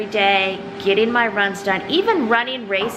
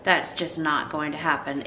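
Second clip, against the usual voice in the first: lispy, whitening strips; 6 semitones lower, 5 dB quieter than usual. Real, not acted. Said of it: "If I sound lispy".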